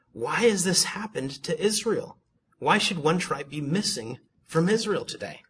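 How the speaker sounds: high questioning plea, lightly acted; crying out. A narrator's reading. Israel asking God why, high mild lament. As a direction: lift the pitch into a read-out lament. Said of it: "they cried".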